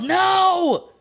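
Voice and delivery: sudden high shouted no; a loud, high outburst of no. Retold or imitated. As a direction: yell it to block someone's way. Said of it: "when she yelled".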